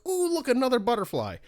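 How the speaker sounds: high, gushing "ooh" at a flower; a gamer cooing at every shiny thing; mock delight. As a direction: squeal with sudden distracted wonder. Voicing his distracted self.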